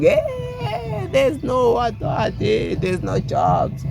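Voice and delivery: high, hammed-up whining with a fake sob; mock crybaby whine. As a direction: mimic a whiny crybaby, nye nye nye. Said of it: "cry baby shit".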